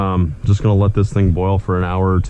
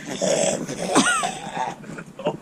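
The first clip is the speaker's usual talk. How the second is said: disgusted laugh; 5 dB quieter than usual. Real, not acted. Laughs at awful stench.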